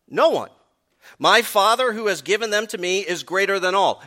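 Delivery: loud, high fervent preaching; preaching at full force. Real, not acted. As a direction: drive the promise home to the congregation. Preaching the security passage.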